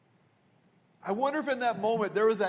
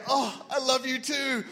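high, drawn-out mock swoon; hammed-up loving reply; gushing delight; 8 semitones higher, 3 dB louder than usual. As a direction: gush it like an overjoyed sweetheart. Acts the hoped-for reply on date.

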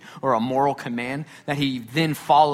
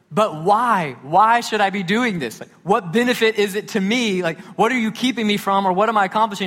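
put-on protesting child; 6 semitones higher, 4 dB louder than usual. Acting out his questioning young son.

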